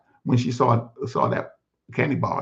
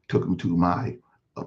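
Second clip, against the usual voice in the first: usual speaking voice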